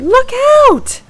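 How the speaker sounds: loud high alarmed cry; cried out. A narrator's reading. Sarah warns Sam of a creeping figure, loud cry. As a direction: call out a warning to her friend. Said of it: "she cried".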